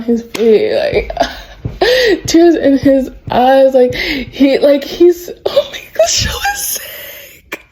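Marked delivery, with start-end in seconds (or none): 5.5-7.6 very high, tearful-sounding squeal; overwhelmed. Real, not acted. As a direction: squeal it, voice thinning out to almost nothing. Overcome by the retold crying scene.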